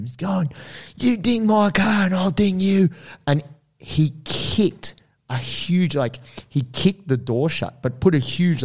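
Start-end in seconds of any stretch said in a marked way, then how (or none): 0.9-2.9 high-pitched retold threat; imitated angry yelling. Big guy threatens old man over car door, angry mock yell. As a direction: play the big aggressor yelling in someone's face. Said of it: "yelling in his face".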